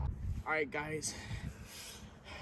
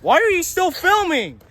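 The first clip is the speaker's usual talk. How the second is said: high, loud mock outrage; exasperated shout; 10 semitones higher, 13 dB louder than usual. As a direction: yell at whoever is holding the camera. Snaps at camera after failed flight.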